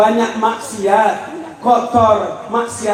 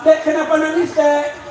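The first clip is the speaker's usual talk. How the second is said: lightly voiced neighbour, a little higher; concerned neighbour; 8 semitones higher than usual. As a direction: play a neighbour questioning a crying child. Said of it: "kata tetangga".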